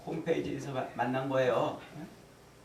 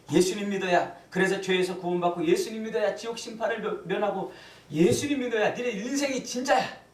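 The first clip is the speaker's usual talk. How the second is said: raised, insistent witnessing, hitting each clause; pretend bold proclamation; 6 semitones higher than usual. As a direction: build higher as if preaching to old friends. Imagined bold witness to classmates. (친구들에게 선포하듯 점점 높여 힘주어)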